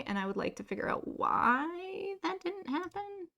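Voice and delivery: ordinary delivery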